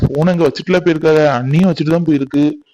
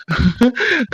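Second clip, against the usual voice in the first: nervous laugh; 7 semitones higher than usual. Acted. Anxious mother waiting, worried aloud.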